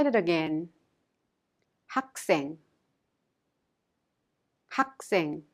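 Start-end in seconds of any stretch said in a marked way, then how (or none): 1.9-5.4 학생 with a tense, hard ss, said as 학쌩; strong consonant demo. Shown as a demonstration. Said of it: "pronounced in a strong sound like 학생".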